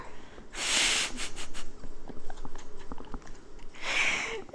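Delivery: very high, breathless squeal of laughter; airy high-pitched cackle. Real, not acted. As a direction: let a high giggle run out of you. Laughing at the Bella quote.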